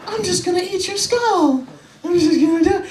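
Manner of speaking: put-on very high, strained voice, a crazed threat to a toddler; creepy monster threat. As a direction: play a hungry monster, squeaky and unhinged. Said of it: "look at the little kid right in the face and be like".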